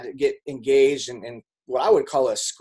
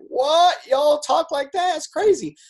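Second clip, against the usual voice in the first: much higher, louder shocked shout, slowing down; shocked disbelief; 6 dB louder, slower than usual. Retold or imitated. Guest reacting to the family's yelling, in high shouted disbelief. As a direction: blurt it out in utter disbelief. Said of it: "And I'm like".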